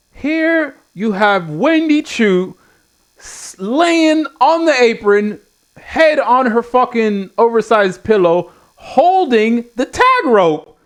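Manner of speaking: slow, high, drawn-out disbelief; exasperated presentation. Real, not acted. Presenting the absurd image on screen.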